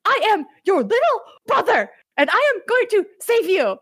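high squeaky mock outburst; mock Luffy shout. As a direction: yell it like a defiant kid brother. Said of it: "this moment where Luffy's like".

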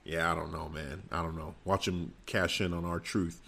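normal talking voice

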